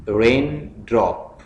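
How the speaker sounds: रेन drop as two separate beats, pausing before drop; split into syllables. Shown as a demonstration. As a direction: say रेन, pause, then drop. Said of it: "syllables में divide करना है".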